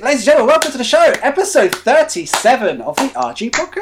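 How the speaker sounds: show announcer. Pretend. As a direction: open the show like a presenter.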